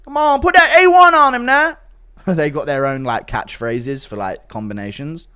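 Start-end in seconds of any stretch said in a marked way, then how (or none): 0.0-1.8 high, loud hyped cornerman shout; mock cornerman yell. Cornerman shouting instructions to his fighter.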